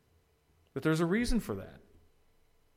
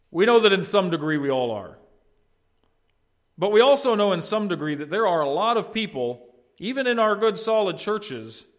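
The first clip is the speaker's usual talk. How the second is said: loud, raised pulpit voice; emphatic, projected, slowing at the end; forceful preaching; 6 semitones higher, 9 dB louder than usual. Real, not acted. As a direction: declare it to the whole room.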